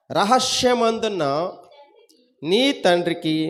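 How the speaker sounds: slow, drawn-out Bible verse with long pauses; scripture recitation. Acted. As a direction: recite the verse phrase by phrase, stretching the words.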